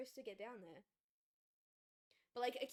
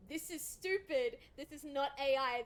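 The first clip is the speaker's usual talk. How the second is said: loud, higher-pitched imitation of angry commenters; mock outrage; 4 semitones higher, 8 dB louder than usual. Fans flooding the voice actor's comments, mock outrage. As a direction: hammer it out like an indignant comment. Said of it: "got absolutely flooded with".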